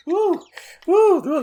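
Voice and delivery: very high, loud whoops of leftover laughter; laughing it off. Real, not acted. Host shaking off a laughing fit, high loud whoops. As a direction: whoop out the last of the giggles.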